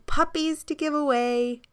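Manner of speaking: put-on high, bright calling of children hawking puppies; kids calling out. A narrator's reading. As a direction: call it out like kids at the park. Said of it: "walked to the park shouting".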